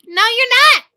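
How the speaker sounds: high, loud, disbelieving denial; emphatic contradiction. Real, not acted. Host to guests who say they're in, loudly disbelieving. Guests claim they are in.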